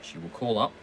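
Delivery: usual speaking voice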